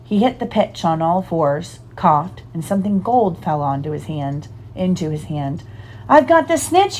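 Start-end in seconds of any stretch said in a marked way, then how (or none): 6.1-7.0 high-pitched narrated shout of triumph. A narrator's reading. Harry announcing his catch to the crowd, excited shout. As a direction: call it out much higher, only a little louder. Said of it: "he shouted".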